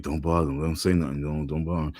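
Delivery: low, soft warning to others; hushed warning. Retold or imitated. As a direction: keep it down, he's here. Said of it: "everybody gonna be like".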